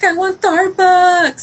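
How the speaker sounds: put-on high, slow child voice; retold kid's whiny request; imitated little girl. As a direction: draw it out like a pleading four-year-old. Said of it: "She's like".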